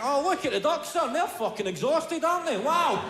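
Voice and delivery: dad showing his son the ducks, mock amazed. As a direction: play the wide-eyed dad at the pond.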